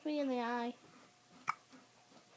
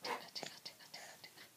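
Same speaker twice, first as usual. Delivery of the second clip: whispering; 19 dB quieter, faster than usual. Real, not acted. Playful tickling of baby.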